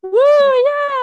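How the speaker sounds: loud, high whoop; excited cheer. Real, not acted. Friend cheering the superlative results, loud excited whoop. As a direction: whoop it up for the winners.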